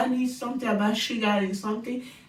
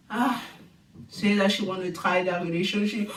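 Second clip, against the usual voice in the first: ordinary delivery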